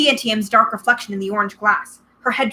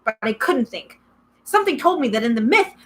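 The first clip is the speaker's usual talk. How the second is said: ordinary delivery, nothing marked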